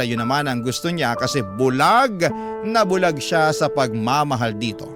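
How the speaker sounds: normal talking voice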